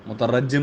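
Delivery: مترجم as "mutarajjim", with doubled j; wrong pronunciation. Shown as a demonstration. Teacher rejecting a form that does not exist, wrong pronunciation. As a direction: say مترجم as "mu-ta-RAJ-jim". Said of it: "غلط ہے".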